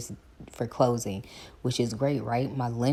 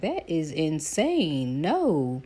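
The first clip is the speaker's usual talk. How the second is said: slow, higher, disbelieving; mock shocked lender; 5 semitones higher, 4 dB louder, much slower than usual. Lender reacting to the bid, slow mock disbelief. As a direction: drag out the shock, slowly. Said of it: "He was like".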